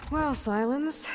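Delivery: slow, drawn-out rising well; dry mock welcome, stretched out; sarcastic greeting. Aloy needling Sylens as he waits in her room, sarcastic and drawn out.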